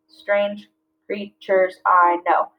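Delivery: slow, each syllable spaced out; slow syllable-counting read. Shown as a demonstration. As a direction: clap out each beat. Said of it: "clapping it out".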